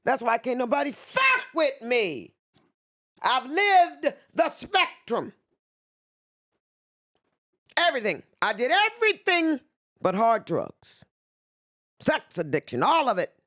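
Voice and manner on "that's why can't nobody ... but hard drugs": high, ringing and defiant; defiant pride. Real, not acted. Telling the interviewer nobody can touch her, high and defiant. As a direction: declare it like a victory cry.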